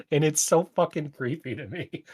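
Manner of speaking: normal talking voice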